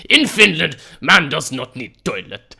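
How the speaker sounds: loud, hammed-up Finnish accent; bad Finnish accent. Gamer joking that Finns need no toilet, bad Finnish accent. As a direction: declare it in a badly faked Finnish accent. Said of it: "I cannot do your accent".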